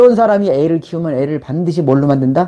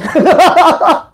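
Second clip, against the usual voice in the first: high burst of laughter; amused laugh; real laugh; 12 semitones higher, 3 dB louder than usual. Real, not acted. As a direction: laugh at the memory. Laughs retelling mother story.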